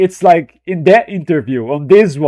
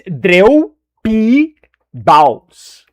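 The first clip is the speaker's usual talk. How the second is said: very slow, drawn-out Brazilian "Dreu P Baus"; teasing Brazilian accent; Brazilian pronunciation; 4 semitones higher, 4 dB louder, much slower than usual. Retold or imitated. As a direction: stretch every syllable the Brazilian way. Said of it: "That's how Brazilian would say that".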